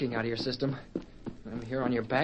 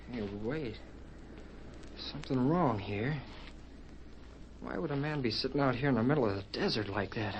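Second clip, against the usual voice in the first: hushed suspicion; 2 semitones lower, 7 dB quieter than usual. Acted. Boy suspects something is wrong.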